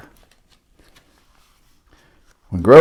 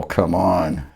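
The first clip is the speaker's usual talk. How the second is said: ordinary delivery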